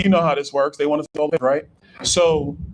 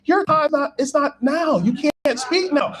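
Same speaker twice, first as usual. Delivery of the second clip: pitched higher, slower at the end, a lightly voiced stern organizer; half-hearted impression of a strict event staffer, higher; mock staffer refusal; 8 semitones higher, slower than usual. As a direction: play the fussy staffer turning him away, higher. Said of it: "She's like".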